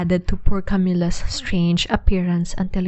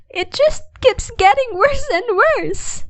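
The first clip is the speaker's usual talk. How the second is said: starting to sob. A narrator's reading. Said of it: "she began to sob".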